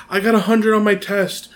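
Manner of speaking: put-on a small boy showing off his test score; proud little Harry. Young Harry eagerly telling his aunt about his test.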